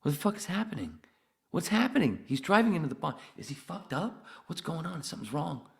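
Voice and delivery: high, frantic disbelief; freaking out as the car heads for the pond; panicked alarm. Retold or imitated. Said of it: "and I'm going".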